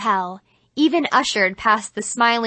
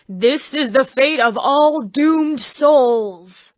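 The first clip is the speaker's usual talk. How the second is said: very slow, louder ominous voice; doomed-souls devil; 4 semitones higher, 6 dB louder, much slower than usual. Acted. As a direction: intone like a haunted-house devil. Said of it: "the devil said".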